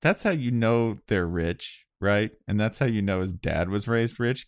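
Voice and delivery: normal talking voice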